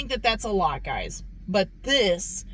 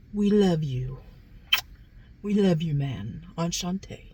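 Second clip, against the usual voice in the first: lightly voiced imitation of a fellow YouTuber, a bit lower; a modest, lower take on another reviewer's catchphrase greeting; Trent impression; 5 semitones lower than usual. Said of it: "my impression of Trent".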